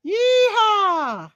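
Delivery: high cowgirl yeehaw; excited whoop. Real, not acted. Streamer cheering for her viewers, excited whoop. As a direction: let out a high country whoop.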